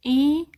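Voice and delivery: إي said high and loud as a pure ee; clear Turkish i. Shown as a demonstration. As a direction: call out إي like English ee. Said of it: "بيتنطق زي حرف الإي". (إي واضحة زي الإي الإنجليزي)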